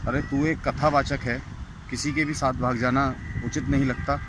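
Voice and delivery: ordinary talk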